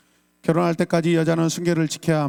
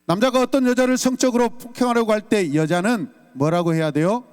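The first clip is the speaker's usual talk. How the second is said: ordinary delivery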